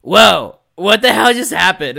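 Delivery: loud, high, dumbfounded dude; K-Dog. Retold or imitated. Game master imitating dumb alter ego waking after boulder hit, loud and high. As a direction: come to as the dopey alter ego, hollering. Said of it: "the dumb personality".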